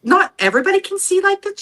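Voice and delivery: shocked realization, voice jumping up high; astonished. Retold or imitated. Learning from sister it's unusual.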